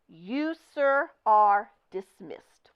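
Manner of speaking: slow, loud cold dismissal; angry dismissal. Acted. Manager furiously firing the star performer. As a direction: dismiss him with rage. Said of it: "purple with rage".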